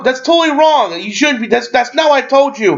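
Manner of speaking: high-pitched showy reproach; hammed-up disapproval; mock scolding. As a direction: scold him for appearances, pitched well up. Acting Deadpool's showy disapproval.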